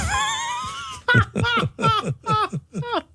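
high shrieking burst of laughter; laughing. Real, not acted. Host laughing at the joke's punchline, high shriek. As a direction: crack up at the punchline.

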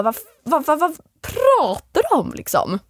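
high, bewildered what-what; baffled. Retold or imitated. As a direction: stammer it out, confused and high. Confused reply in retold fight. (förvirrat och högt)

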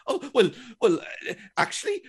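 high, loud stammered protest; flustered defensiveness. Acted. Someone just given out to defends their position, flustered. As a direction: stumble into a mock defensive comeback. Imagined defensive reply to scolding.

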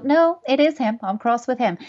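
hammed-up indignant objection; defensive parent. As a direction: play the parent who won't admit it. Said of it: "listening to me going".

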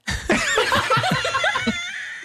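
high burst of laughter; laughing. Acted. Crybaby guest laughs before his confession. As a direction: break into a high giggle. Improv character laughs at start.

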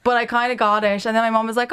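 normal talking voice

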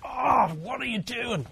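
a mild hammed-up road-rage oh; imitating an exasperated driver, drawn out; annoyed driver. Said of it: "got cut off by another driver, like".

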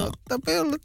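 a hammed-up Savo dialect line. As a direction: give it a playful Savo accent. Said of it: "savolaisia saatan".

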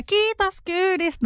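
high, slow, bright thank-you; cheerful thanks. Retold or imitated. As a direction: thank the driver cheerily, slowly. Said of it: "kiitos ja iloinen ja reipas". (iloinen ja reipas kiitos)

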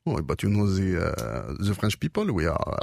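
hesitant, low mock-French English; French accent. Shown as a demonstration. Demonstrating French people to co-host, mock French accent. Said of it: "Jag hörde lite fransk".

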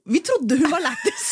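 giggling while telling it; laughing. Real, not acted. Telling a friend how the hall laughed, giggling.

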